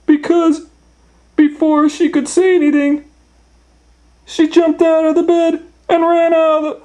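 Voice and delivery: very high, loud squealed storytelling; alarmed narration. A narrator's reading. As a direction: tell it in a panicked high squeal. Narrating Goldilocks waking in fright.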